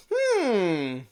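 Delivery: long drawn-out "hmm", sliding down; mock pondering. Commentator mocking a comparison with exaggerated pondering. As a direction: pretend to weigh an obvious answer. Mock weighing of two unequal options.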